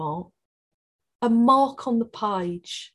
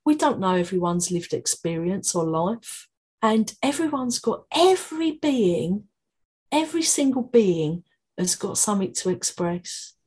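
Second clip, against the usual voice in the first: usual speaking voice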